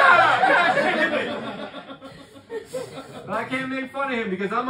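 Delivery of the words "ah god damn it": high, slow, laughing dismay; a drawn-out cry of mock despair; dismayed groan. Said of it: "I'm like".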